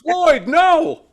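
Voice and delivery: high, loud cry of mock dismay; mock scolding shout. Jokingly scolding Floyd the puppet, loud mock outrage.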